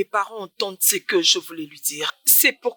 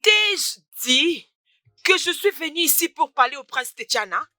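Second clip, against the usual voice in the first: furious raised voice at a nosy friend; angry shout; 6 semitones higher than usual. Acted. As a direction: shout her down in anger. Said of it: "Mais pourquoi tu cries?".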